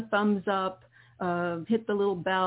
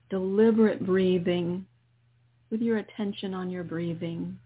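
guided meditation. Acted. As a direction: ease the listener into calm breathing. Leads a guided healing meditation.

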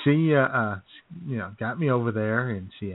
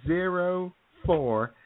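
very slow, higher, each number drawn out; slow typing out; 7 semitones higher, much slower than usual. Retold or imitated. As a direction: read the numbers like slowly typing them. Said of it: "She goes".

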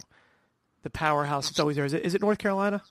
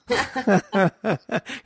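laugh; 6 semitones higher than usual. Real, not acted. Laughing at own joke.

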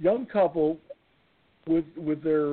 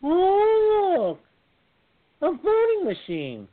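high squeaky cartoon voice; high silly voice; 4 dB louder, much slower than usual. Firefighter joking to his daughter, high cartoon squeak. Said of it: "So I went".

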